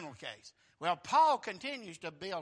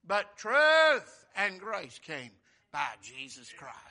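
preaching emphasis; 10 dB louder, slower than usual. Acted.

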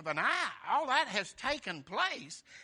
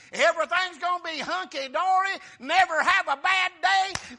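high, loud carefree boast; loud, giddy daydream; naive optimism; 7 semitones higher, 8 dB louder than usual. Retold or imitated. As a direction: gush like nothing can go wrong. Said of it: "you ever have your mind made up".

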